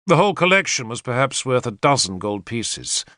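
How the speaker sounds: plain reading voice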